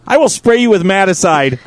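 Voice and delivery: usual speaking voice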